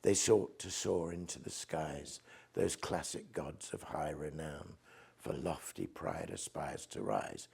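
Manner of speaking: slow, soft recital of the lines; poetry recital. Shown as a demonstration. Poet to host, reciting his own stanza: slow soft reading. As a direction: read the verse slowly and quietly. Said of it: "You're expecting the next line".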